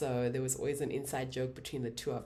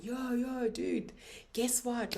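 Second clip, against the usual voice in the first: higher, eager call to a buddy; excited friend call; 8 semitones higher, slower than usual. Retold or imitated. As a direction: buzz with news for your best friend. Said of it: "And he was so excited".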